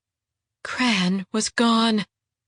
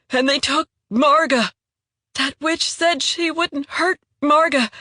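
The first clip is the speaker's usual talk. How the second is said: higher, strained voice of a desperate wounded man; pleading wounded traitor; 6 semitones higher than usual. Acted. Wounded traitor pleading with Jandara, higher and strained. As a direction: beg her to believe you, voice pitched up in pain.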